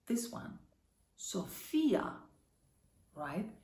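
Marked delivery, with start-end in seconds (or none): 1.1-2.1 Sophia with the middle syllable FI stressed. Shown as a demonstration. Teacher reading an example word, Sophia stressed on FI. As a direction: say Sophia as so-FEE-a. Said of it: "It's phi, E, Sophia".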